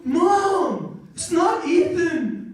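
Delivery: high, drawn-out mock whine; hammed-up exasperated teen; whiny wannabe gangster. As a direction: protest to mom, stretching every word. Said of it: "those fake wannabe gangsters".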